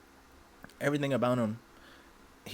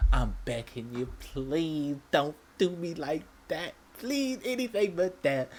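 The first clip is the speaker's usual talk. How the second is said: high, slow, hammed-up begging; mock pleading prayer; 7 semitones higher, slower than usual. Mock-praying to God not to be reborn an elf, hammed-up begging. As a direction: beg the heavens in mock despair.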